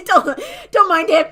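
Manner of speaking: high, loud words through laughter; laughing. Real, not acted. Streamer cracking up at her bird, laughing. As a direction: giggle it out helplessly.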